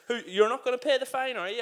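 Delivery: reliving the fury at the fine; fuming. Retold or imitated. Telling the story to his podcast co-host, a retold fuming rant. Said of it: "I was, like, fuming".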